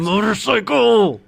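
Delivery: playful shout. Pretend. Loud playful echo.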